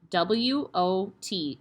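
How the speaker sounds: usual speaking voice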